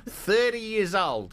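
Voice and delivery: high, slow hammed-up Aussie accent; mock Australian. Host repeating the clue in a slow mock Aussie accent. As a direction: stretch it out in a silly Aussie voice.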